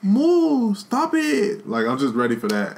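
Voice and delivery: ordinary talk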